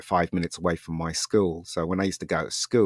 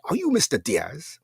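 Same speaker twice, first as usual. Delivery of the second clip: snooty man; 8 semitones higher than usual. Retold or imitated. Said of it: "this really snooty guy".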